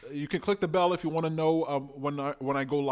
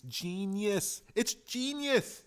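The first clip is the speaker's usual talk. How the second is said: savoured praise; 6 semitones higher, much slower than usual. Acted. Praising giveaway, drawn out.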